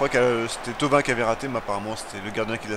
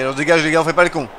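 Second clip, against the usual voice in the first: urgent shouting; 4 semitones higher, 9 dB louder, faster than usual. Real, not acted. Ordering a clearance.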